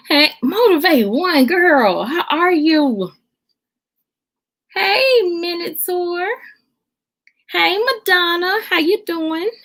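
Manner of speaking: high, lilting hello; delighted greeting. Real, not acted. Streamer welcoming viewers by name, delighted greeting.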